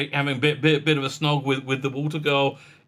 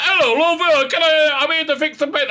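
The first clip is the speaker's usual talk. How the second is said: English repairman voice; 10 semitones higher, 5 dB louder than usual. Retold or imitated. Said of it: "It was like".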